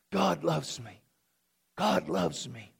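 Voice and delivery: low and soft, savoring it; soft echo. Real, not acted. Pastor letting the words echo in hearts, soft. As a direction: let it echo quietly. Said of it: "soft echo".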